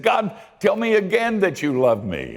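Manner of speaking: a dancing man begging God to say it; high, giddy Gideon begging to hear he's loved. Acted. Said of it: "and he said".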